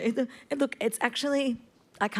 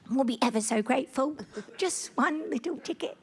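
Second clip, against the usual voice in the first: pleading orphan voice, ever so grateful; plucky hopeful orphan; 4 semitones higher than usual. Pretend. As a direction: beg sweetly like a little orphan. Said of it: "do a poor orphan voice".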